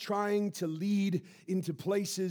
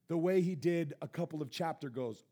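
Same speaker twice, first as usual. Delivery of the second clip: ordinary talk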